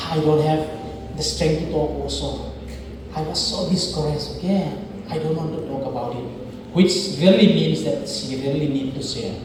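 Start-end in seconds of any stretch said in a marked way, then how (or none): 0.1-6.3 tired, softer discouraged reply. Shown as a demonstration. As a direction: sound too drained to talk. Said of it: "She said".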